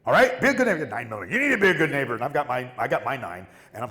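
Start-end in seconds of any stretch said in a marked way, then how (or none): 0.1-0.8 high, loud, menacing warning; mock menacing threat; threatening neighbor. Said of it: "he's like". 1.3-2.1 high, loud, menacing warning; mock menacing threat; threatening neighbor. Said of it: "he's like".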